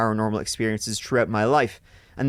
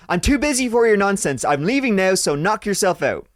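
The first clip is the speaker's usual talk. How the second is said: higher, louder defiant shout; 8 semitones higher, 4 dB louder than usual. Retold or imitated. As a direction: yell it up the stairs. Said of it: "went to the bottom of the stairs and shouted".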